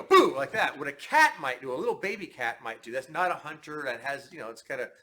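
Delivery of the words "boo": loud, high "Boo" to spook a kitten; playful scare shout. Shown as a demonstration. Instructor shows spooking a baby kitten with a shout. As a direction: jump at it with a sudden "Boo". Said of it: "and you go".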